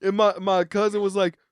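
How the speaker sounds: usual speaking voice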